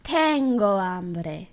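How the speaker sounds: very slow, stretched "Tengo hambre"; slow imitation. Learner copying the translator because it goes slow, drawn-out imitation. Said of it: "When it does it so, I gotta do a so too".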